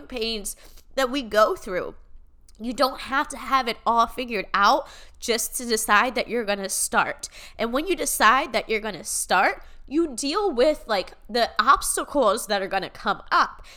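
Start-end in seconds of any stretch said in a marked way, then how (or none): none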